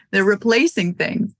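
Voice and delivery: ordinary talk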